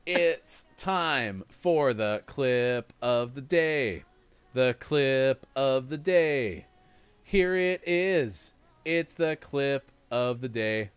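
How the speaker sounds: drawn out, sing-song, every word stretched slowly; slow announcer voice. Acted. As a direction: announce it like a slow, grand show host. Drawn-out clip-of-the-day announcement.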